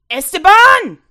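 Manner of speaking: high, very loud call from afar; mother's scream. Acted. Mother screaming for her son again. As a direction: yell the name across the desert.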